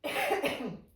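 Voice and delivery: short dry cough. Real, not acted. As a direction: cough dryly. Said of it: "Petit début de toux sèche".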